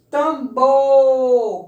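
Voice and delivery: "Tambor" with BOR very loud and held long; stressed syllable drawn out. Shown as a demonstration. Teacher showing pupils the stressed syllable, last one loud and long.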